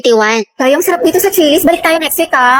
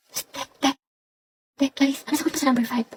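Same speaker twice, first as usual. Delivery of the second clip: hushed exam-time whisper; very soft, secretive murmur; 2 semitones lower, 12 dB quieter than usual. Acted. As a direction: whisper furtively to a classmate for an answer. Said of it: "may naririnig na ako nagbubulungan".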